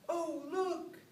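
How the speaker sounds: high, sweet gossiping neighbor; gossipy neighbor voice. Retold or imitated. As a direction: coo like a nosy neighbor.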